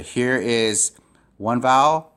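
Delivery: ordinary talk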